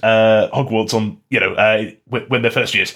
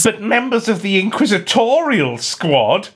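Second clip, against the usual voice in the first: Malfoy voice; 8 semitones higher than usual. Acted. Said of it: "read them out with voices".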